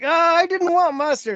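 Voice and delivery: put-on high whiny child; whiny kid voice. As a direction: whine it like a little kid. Kid quoted whining about mustard.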